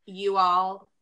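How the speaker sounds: slow, emphatic, over-careful "You all"; very formal. Shown as a demonstration. Southerner mocking the stiff alternative to y'all, very formal. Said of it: "it sounds very formal".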